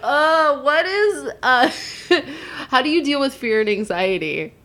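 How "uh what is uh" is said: laugh-crying. Real, not acted. Laughing, crying asking question.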